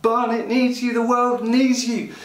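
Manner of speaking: high, loud desperate cry to the Father; crying out like a baby. Shown as a demonstration. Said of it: "like newborn babies that cry".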